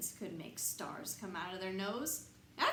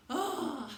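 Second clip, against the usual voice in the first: exhausted groan; 4 semitones higher than usual. Pretend. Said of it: "I'd be exhausted".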